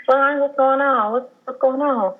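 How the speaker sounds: worried coaxing. Retold or imitated. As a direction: coax the baby, voice lifted. Coaxing her unborn baby to move.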